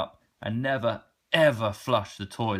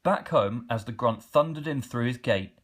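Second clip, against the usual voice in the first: usual speaking voice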